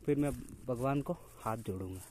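normal talking voice

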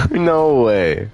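high laugh into the words. Real, not acted. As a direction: laugh while protesting. Laughing at being caught.

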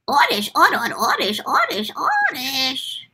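odd creature cry, slowing down; Oddish voice. Acted. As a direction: chirp then drawl the name. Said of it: "Oddish in Pokemon".

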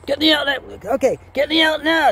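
high, loud frantic pleading; comic escalating alarm; panicked demand. Acted. Frightened turkey demands escape.

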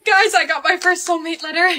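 high, gushing news to friends; excited announcement. Acted. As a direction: burst out with it, pitch way up. Telling friends the big news.

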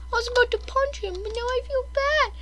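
very high, small pitying voice; pitying squeak. Acted. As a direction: squeak it softly with pity. Pities the homeless cat.

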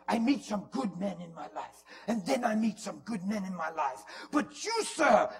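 put-on loud Spanish-accented declaration, building to a high shout; hammed-up accented proclamation; aggressive Spanish man. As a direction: imitate a man insisting at full volume. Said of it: "And he's so aggressive".

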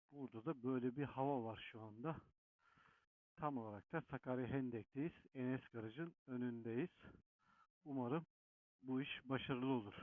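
muffled from inside a helmet; talking through a closed helmet. Real, not acted. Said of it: "kaskın içinden konuşuyorum".

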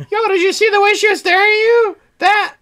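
put-on high, loud, giddy fan kid; excited kid voice. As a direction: gush like an overexcited kid.

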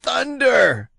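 loud, very high outburst; old captain's loud outraged cry. A narrator's reading. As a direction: bellow it in shock. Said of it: "he cried".

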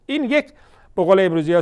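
usual speaking voice

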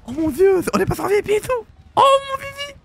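high-pitched thrilled squeal; excited squeal. Real, not acted. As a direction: squeal it with delight. Thrilled at entering the VIP.

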